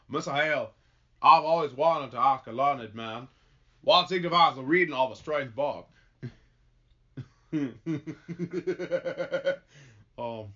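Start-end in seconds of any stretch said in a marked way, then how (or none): 0.1-5.9 plain countryman's stage voice; put-on folksy accent, earnest asking; rustic questioner. Old farmer asks minister about strange books, folksy. Plays character in broad drawl.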